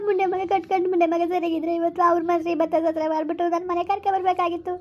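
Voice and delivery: crying as she talks, voice rising into a wail; tearful lament. Acted. Mother mourns the ceremony held at her own house, crying. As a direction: lament bitterly through tears. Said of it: "ಅಳ್ಬೇಡಿ, ಅಳ್ಬೇಡಿ, ಸುಮ್ಕಿರಿ".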